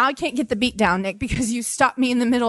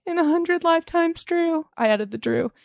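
poem reading; 2 semitones higher than usual. Acted. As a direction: swell up on the name like a vow. Reads a love poem to husband.

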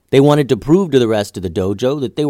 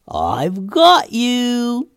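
high, slow, taunting sprite voice; wood sprite voice; 13 semitones higher, much slower than usual. Acted. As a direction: gloat like a mischievous forest sprite. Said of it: "said the sprite".

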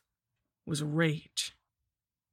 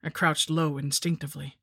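ordinary delivery

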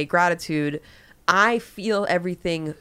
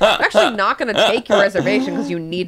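laughing mock hostess; 2 semitones higher, 6 dB louder than usual. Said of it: "the hostess is like".

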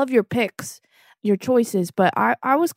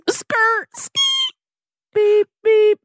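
tire screech and reverse beeps; 13 semitones higher, slower than usual. Acted. Piglet mimicking a car reversing, screeching and beeping. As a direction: screech to a halt and beep backward.

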